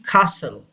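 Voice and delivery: "castle" said as kah-sul; silent T. Shown as a demonstration. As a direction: drop the T in "castle". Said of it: "टी की आवाज़ नहीं आएगी". ("castle" में टी silent, "कासल")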